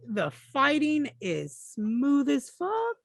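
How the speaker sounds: high, stretched-out awe; drawn-out hyped reaction; excited gushing. Retold or imitated. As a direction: gush slowly in a high, impressed voice. Gushing over game combat footage.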